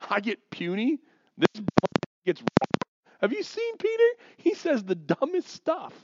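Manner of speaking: high, incredulous mock squeal; indignant protest. Paul complaining to God about Peter's name, indignant. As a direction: whine in outrage at the unfairness, pitch shooting up.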